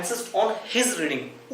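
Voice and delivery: very slow, word by word; slow dictation. Shown as a demonstration. Teacher dictating a sentence to students, very slow. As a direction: spell it out slowly so students can write.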